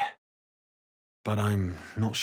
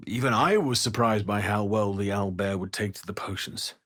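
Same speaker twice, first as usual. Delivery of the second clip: ordinary delivery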